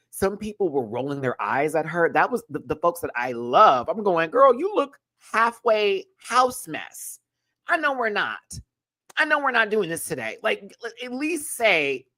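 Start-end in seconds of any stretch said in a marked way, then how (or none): 4.3-7.2 much higher, slow and drawn out; high mocking voice. Mocking shady quote. 7.7-12.1 high sassy voice. Retold or imitated. Sassy high-pitched run.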